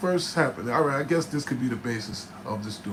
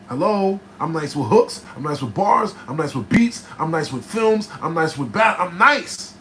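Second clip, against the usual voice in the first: louder and higher, hyping up each skill; loud boasting; 4 semitones higher, 7 dB louder than usual. Real, not acted. Bragging to viewers about his skills, loud and building. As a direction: stack the brags and climb to the last one.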